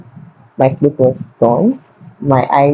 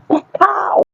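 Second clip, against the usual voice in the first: a high, sharp "pow" for the slap; acting out the sound of the slap he gave her, high and sharp; 4 dB quieter than usual. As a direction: snap out the sound of a hand striking a face.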